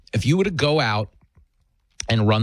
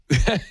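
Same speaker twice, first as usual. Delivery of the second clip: short laugh after a joke; amused laugh; 3 semitones higher than usual. Real, not acted. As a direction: laugh at the clip's punchline. Laughs at played retort.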